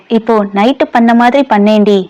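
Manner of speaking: ordinary delivery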